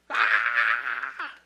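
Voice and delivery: loud, low mock scream of the scared disciples; short hammed-up terrified cry. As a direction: shriek like a frightened sailor. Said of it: "Empezaron a gritar".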